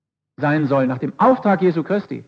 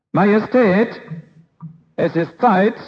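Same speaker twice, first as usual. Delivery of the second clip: servant calling louder and higher; much louder; 4 semitones higher, slower than usual. Acted. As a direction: raise the voice to rouse the king. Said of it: "jetzt schon viel lauter". (Diener ruft den König deutlich lauter)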